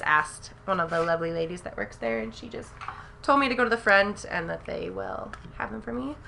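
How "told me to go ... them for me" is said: normal talking voice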